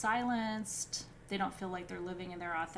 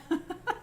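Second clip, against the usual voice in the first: short high giggle; giggling at it being over; giddy laugh; 10 semitones higher, 4 dB louder than usual. Real, not acted. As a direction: laugh off the strangeness. Giddy at finishing.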